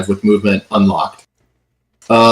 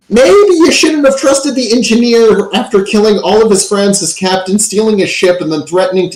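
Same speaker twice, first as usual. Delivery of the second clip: game master's dramatic climax, voice pitched up; building exclamation; 8 semitones higher than usual. A narrator's reading.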